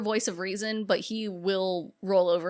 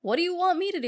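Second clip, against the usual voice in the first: high exasperated whine; mock helpless whine; exasperated plea; 11 semitones higher, faster than usual. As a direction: throw up your hands, pitched up. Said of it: "And he's like".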